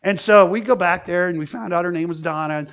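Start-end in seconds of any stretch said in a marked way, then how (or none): none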